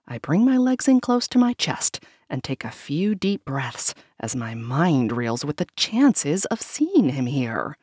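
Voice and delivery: ordinary delivery, nothing marked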